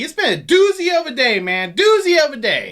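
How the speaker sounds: high, loud mock enthusiasm on doozy; sarcastic. Real, not acted. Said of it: "you're being sarcastic".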